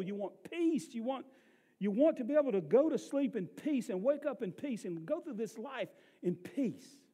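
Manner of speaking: fervent pulpit appeal; impassioned preaching. Real, not acted. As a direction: lift the voice and press the promise home. Preaches at full force.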